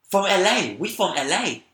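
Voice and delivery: put-on high, loud Chinese-accented broken English; imitated Chinese accent. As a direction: blurt it in a mock Chinese accent. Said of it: "I'm gonna impersonate their accent".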